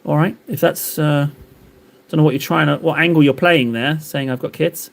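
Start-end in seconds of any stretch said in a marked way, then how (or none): none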